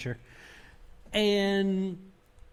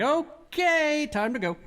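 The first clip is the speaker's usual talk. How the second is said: high, drawn-out okay, then quick retreat; mock-uneasy exit; 11 semitones higher, slower than usual. Investigator retelling his reaction to the turned mannequin, comically uneasy. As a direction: play up a nervous cue to leave.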